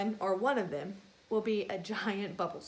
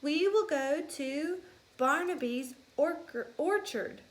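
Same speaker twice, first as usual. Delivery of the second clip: higher teacher voice; teacher announcing; 5 semitones higher than usual. Acted. Teacher mouse telling the class about the trip, higher. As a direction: address the class brightly.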